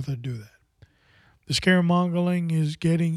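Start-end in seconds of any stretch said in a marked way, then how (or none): 0.0-0.5 whisper. Real, not acted. Lament about churchgoers.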